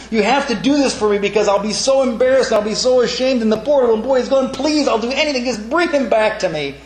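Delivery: mock panic. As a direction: play the flighty grandma begging God in a mock panic. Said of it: "And she's terrified".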